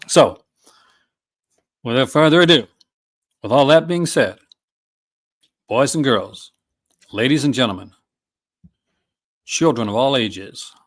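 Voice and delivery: put-on big ringmaster announcement, boys and girls; hammed-up circus barker opening the show; mock showman intro. Vlogger kicks off the release rundown for chat, mock ringmaster call.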